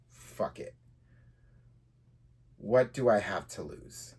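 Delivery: usual speaking voice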